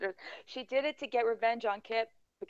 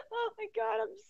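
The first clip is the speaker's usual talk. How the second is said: ordinary delivery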